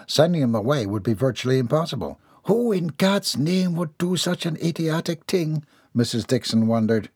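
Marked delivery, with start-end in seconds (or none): 2.5-5.6 exasperated wondering. A narrator's reading. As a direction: ask it in disbelief and irritation. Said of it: "Then she snarled".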